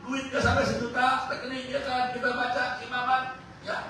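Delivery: high, loud, fervent preaching with laughs; full-force preaching. Acted. Preacher proclaims Colossians to the congregation fervently. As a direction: proclaim it to the whole church.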